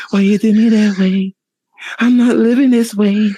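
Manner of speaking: hammed-up wounded pop star. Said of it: "He was like".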